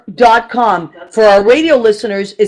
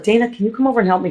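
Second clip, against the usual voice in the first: fast pleading; 6 dB quieter, much faster than usual. Retold or imitated. Host imitates asking neighbor.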